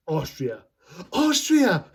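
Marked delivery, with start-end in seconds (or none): 1.1-1.8 much higher, hammed-up astonished repeat; high-pitched, over-excited question; excited movie-quote outburst. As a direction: blurt it back like a goofy comic. Acting out Lloyd's loud reply.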